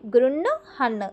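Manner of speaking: గృణ్ హన్ in two parts, nasal గృణ్ before హన్; split pronunciation. Shown as a demonstration. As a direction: sound the ణ్ of గృణ్ first, then a separate హన్. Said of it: "ఆ ఒత్తుని first పలకాలి". (గృణ్ హన్: ముందు ణ్, తర్వాత హ పలకాలి)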